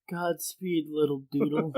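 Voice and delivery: soft, defeated farewell. Acted. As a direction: say it quietly, all hope gone. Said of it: "he's so, like, defeated".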